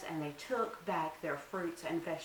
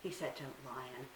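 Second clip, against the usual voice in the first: ordinary delivery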